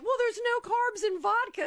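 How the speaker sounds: high, loud, breezy excuse voice; mock excuse. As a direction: chirp out a silly excuse. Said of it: "it was like".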